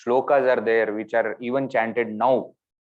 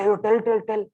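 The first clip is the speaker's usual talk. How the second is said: urging friends; 10 semitones higher than usual. Retold or imitated. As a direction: tell us, come on. Friends urging her to tell.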